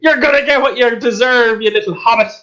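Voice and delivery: high-pitched shout without real volume; angry guard's outburst, voiced high but held back; mock shout. Guard snapping at a chatty prisoner after a failed whisper, mock shouting. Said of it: "so he winds up shouting".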